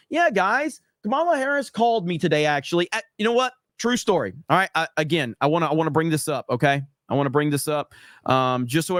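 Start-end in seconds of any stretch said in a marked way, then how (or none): 0.1-4.3 pitched up high, playfully boastful announcement; mock bragging. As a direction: show off the news in a hammed-up high voice. Mock brag about a call.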